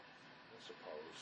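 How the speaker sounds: breathy whisper, giving in; whispered grudging agreement. Acted. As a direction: barely concede it under your breath. Grudging reply in argument.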